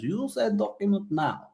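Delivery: big biker character in a high put-on accent; Ivan. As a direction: play a biker with a hammed-up accent. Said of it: "sorry, do that accent again".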